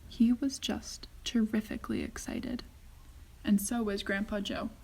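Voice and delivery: usual speaking voice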